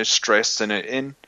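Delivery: ordinary talk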